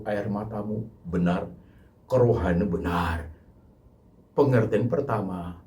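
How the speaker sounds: ordinary talk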